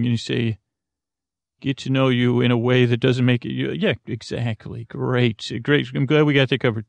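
ordinary talk, nothing marked

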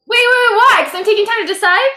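high, fast panicked protest; alarmed surprise. Real, not acted. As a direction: blurt it out in alarm. Asked to roll suddenly.

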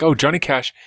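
usual speaking voice

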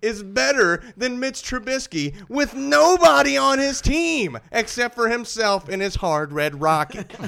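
high, loud hyped rant; hyped announcer. Acted. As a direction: roast him like a showman. Hyped roast after reveal.

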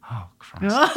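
mock dismay. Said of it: "And you're like".

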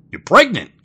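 high, loud astonished cry; a husband stunned by his wife's belly; shocked gasp. Acted. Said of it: "gasped Jack".

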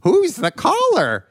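high, swooping, drawn-out character voice; slow it right down and swoop up high, oddly; crazy vocal choice. Retold or imitated. Host imitating actor's crazy vocal choice in Caller One, oddball swooping voice. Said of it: "he made a crazy vocal choice in Caller One".

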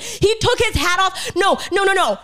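high, hammed-up fan outburst; panicked fan disbelief.

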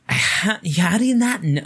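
slow, drawn-out scornful question; mock the boss's disbelief; condescending boss. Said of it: "her voice and her facial expression was like".

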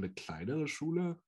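ordinary delivery

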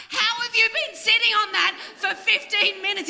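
high, laughing disbelief; incredulous. Retold or imitated. Telling the concierge off, laughing disbelief. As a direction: squeal it through a laugh, amazed.

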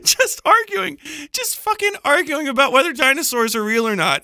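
amused, disbelieving pitch jump; incredulous laugh. Real, not acted. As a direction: squeak it out in disbelief. Incredulous at arguing in war zone.

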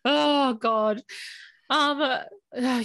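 real tears breaking through the words; choked up, struggling to speak; moved to tears mid-sentence; crying. Real, not acted. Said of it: "I'm already crying".

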